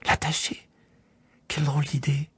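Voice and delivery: soft whispered disbelief, rising indignation; shocked. A narrator's reading. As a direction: scoff at the odd idea. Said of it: "parut choquer le petit prince".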